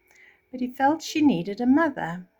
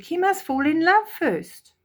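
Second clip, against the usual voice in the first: much higher, louder protest; fretful Grand Duke; 9 semitones higher, 4 dB louder than usual. Acted. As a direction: nervously object to the king. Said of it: "said the Grand Duke".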